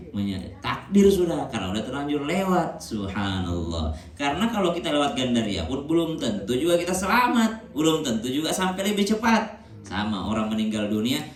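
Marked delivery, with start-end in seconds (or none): none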